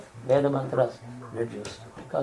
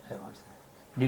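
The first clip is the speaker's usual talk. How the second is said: ordinary talk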